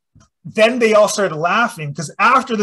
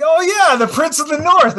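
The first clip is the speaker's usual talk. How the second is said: mock grand announcement; 11 semitones higher, 6 dB louder than usual. Uncle's title mocked in retelling.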